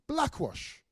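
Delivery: Blackwash called out high; proclaimed word. Acted. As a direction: announce Blackwash like a headline.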